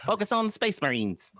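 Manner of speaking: playful put-on Aussie mate; mock Aussie accent. Games Workshop telling rivals to focus, in a mock Aussie accent. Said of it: "Australian or something".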